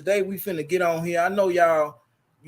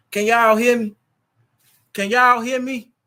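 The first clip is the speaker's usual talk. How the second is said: louder, higher call to the chat; raised voice checking the mic; 7 semitones higher, 6 dB louder than usual. Real, not acted. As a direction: call out to check they can hear. Checking if stream audio works.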